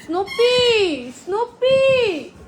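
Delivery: high, slow, drawn-out call of the name; sing-song calling. Real, not acted. Owner calling Snoopy to her, high drawn-out sing-song. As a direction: coax the dog over with a long high call.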